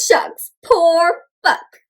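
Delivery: usual speaking voice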